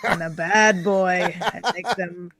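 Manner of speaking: put-on pirate slipping into Scottish. Guest playing Captain T on stream, put-on pirate. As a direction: play a saucy pirate with a Scottish lilt. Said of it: "your pirate, turns into a Scottish voice".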